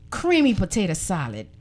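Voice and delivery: ordinary delivery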